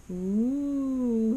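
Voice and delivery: ooh climbing in steps then dropping straight down; walk the voice up the stairs and jump off. Shown as a demonstration. Teacher demonstrates the stairs card with stepped ooh. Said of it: "now let's try it together".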